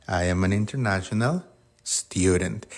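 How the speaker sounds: international student said as /inteɾnasjonal stuɾent/, rising end; English model sentence. Shown as a demonstration. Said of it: "Que sería".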